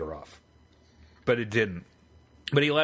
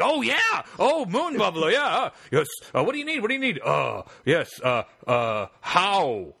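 eager researcher; 6 semitones higher than usual. Retold or imitated. Researcher gushes to the prank caller, high and eager. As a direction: gush like an eager fan.

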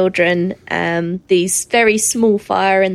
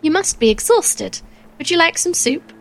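Missus Cratchit voice; 7 semitones higher than usual. Acted.

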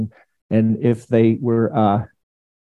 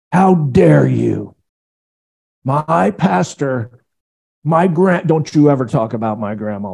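offended churchgoer; slower than usual. Acted. Offended churchgoer voiced mockingly.

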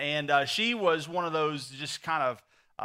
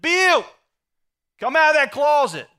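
bossy wife hollering, much higher and louder; commanding shout; 10 semitones higher, 11 dB louder than usual. Acted. Wife ordering her husband out of the closet, commanding shout. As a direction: call him out with a loud, high-pitched order.

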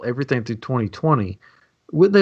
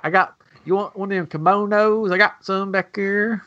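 higher, lightly voiced Southern vendor; mock Southern hawker; 7 semitones higher than usual. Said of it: "We could talk like this".

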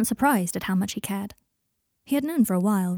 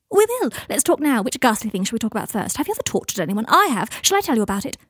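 high, loud opening burst; manic, brittle retort; 3 semitones higher, 4 dB louder than usual. Acted. Draco goading Michael about the war, manic. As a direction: leap in high, then rattle on provocatively.

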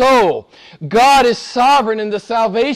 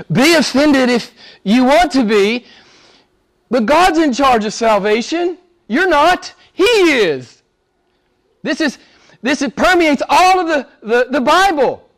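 raised, insistent preacher's voice; fervent preaching; 6 semitones higher, 3 dB louder than usual. Acted. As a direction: press the point hard on the congregation. Preaching at full force.

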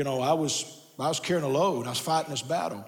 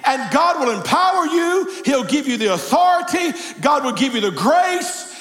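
full-force preaching; 8 semitones higher, 6 dB louder than usual. Real, not acted. Pastor urges congregation to let go into God's hands, impassioned shouted preaching. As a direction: drive it home to the whole congregation, high and ringing.

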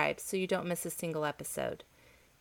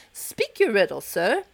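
gaily; 7 dB louder than usual. A narrator's reading. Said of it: "said the king gaily".

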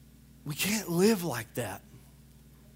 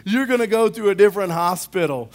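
hammed-up incredulous patient; 8 semitones higher, 10 dB louder than usual. Imagined patient reaction.

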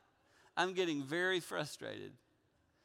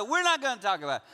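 quick, higher and louder retort; irritated; 6 semitones higher, 6 dB louder, faster than usual. Retold or imitated. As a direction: replay a huffy, hurried refusal. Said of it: "So I got irritated".

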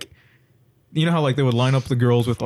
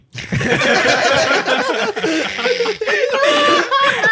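hysterical laughter. Real, not acted. Howling laughter after punchline.

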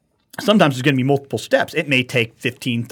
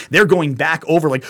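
normal talking voice

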